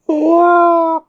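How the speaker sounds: high, loud whale wail; whale song. Retold or imitated. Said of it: "like, a whale song".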